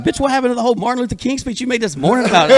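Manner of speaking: high, fast comic outrage; indignant rant played for laughs; retold indignation. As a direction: fire it back in a rush. Acted quarrel, firing back.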